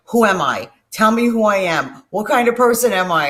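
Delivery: put-on loud, frantic pleading to girlfriend; panicked questions. Calling his girlfriend, loud and frantic. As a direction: demand answers in a panic.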